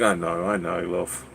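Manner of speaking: low, very soft British endearment; mock-modest reply. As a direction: accept the compliment low and hushed. Retold reply to admirer's compliment.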